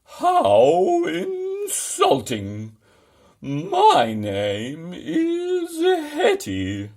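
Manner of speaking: very high, loud, slow, outraged cry; angry growl. A narrator's reading. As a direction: wail it out furious and insulted. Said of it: "glared down with the angriest growl".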